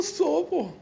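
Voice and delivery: moved reply. Retold or imitated. Grandson answers grandpa, moved.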